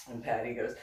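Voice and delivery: plain reading voice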